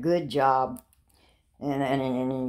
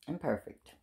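quiet fall; 7 dB quieter than usual. Shown as a demonstration. To her children, "Imperfect" falling soft and final. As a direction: let "Imperfect" drop quietly.

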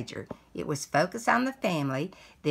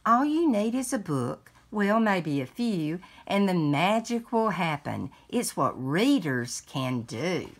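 lively picture-book read-aloud; reading to children. Acted. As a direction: read it like a bedtime story to a grandchild. Resumes read-aloud after aside.

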